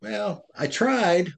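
excuse voice. Retold or imitated. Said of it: "our brain can say".

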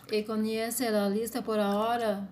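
normal talking voice

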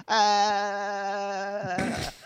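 long, high, steady "aah"; drawn-out high cry, held on one note; imitated kid's wail. Storyteller imitating kid running round with cheese on tongue, mock wail.

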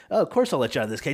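fast, mock-sweet sarcastic promise; quick sarcastic fake kindness; Buggy sarcasm. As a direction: pretend to agree, dripping with sarcasm, quickly. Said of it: "Buggy sarcasm".

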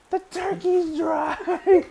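high, slow, wailing mock outrage; squealing melodrama over a dry sandwich; overdramatic Homer. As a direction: whine it like the world is ending. Mocking Homer's overdramatic complaint.

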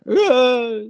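a high, loud wailing "ah"; waily call. Retold or imitated. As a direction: imitate a distant wailing call out in the bush. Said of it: "kinda like a waily kinda noise".

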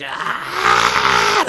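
long held mock scream; loud drawn-out "aah"; imitated screaming kid.

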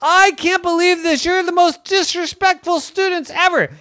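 very high, loud furious wail; really mad. Acted. Girl furious at disrespectful stuffies, hammed-up. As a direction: blow up at the whole class. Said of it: "she got really mad".

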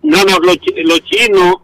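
ordinary talk, nothing marked

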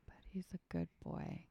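soft musing whisper. Real, not acted. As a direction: murmur it under your breath while thinking. Muses over the clue in whispers.

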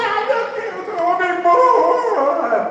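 lamento agudo e arrastado, bem exagerado; carpideira escandalosa. Retold or imitated. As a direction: chora alto como carpideira paga. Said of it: "Escandaloso, espalhar pra todos". (lamento de carpideira, agudo e teatral)

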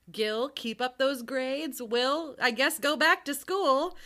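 amused parental pronouncement. Retold or imitated. Parents' speech acted, breaking into laughter.

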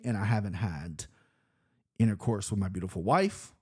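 ordinary talk

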